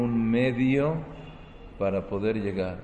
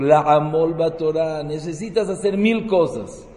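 rising, louder sermon push, slowing on each effort; preaching at full force; 4 semitones higher, 4 dB louder, slower than usual. Acted. Preacher urging his listeners to toil for Torah, fervent sermon. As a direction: hammer home every hardship of learning.

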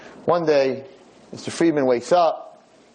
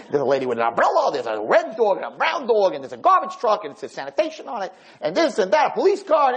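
excited describing; 6 semitones higher than usual. Retold or imitated. Excitedly describing the view outside.

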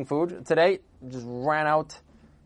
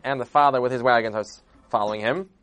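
usual speaking voice

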